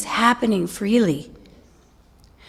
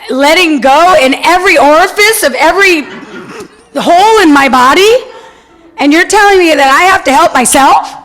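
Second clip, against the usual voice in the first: indignant protest; 4 semitones higher, 8 dB louder than usual. Retold or imitated. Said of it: "I said".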